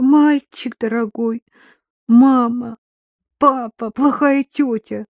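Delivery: put-on drunken, maudlin tenderness, drawn out; tearful pity. A narrator's reading. Drunk mother pictures her children orphaned, tearful pity. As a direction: pity the poor little ones, close to tears. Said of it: "От жалости у Татьяны навернулись слёзы".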